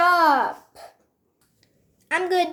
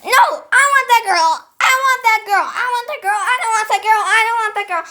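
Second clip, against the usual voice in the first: higher, louder insistent repetition; loud demanding; 6 semitones higher, 6 dB louder, faster than usual. Pretend. Pet insisting on having that girl, loud demanding repeats.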